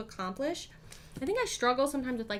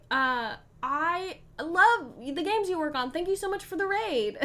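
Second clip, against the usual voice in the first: higher, louder, still thrilled; excited gushing; 7 semitones higher, 5 dB louder than usual. Real, not acted. Streamer gushing thanks to the designer who raided her. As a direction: pour out thanks to a favourite creator.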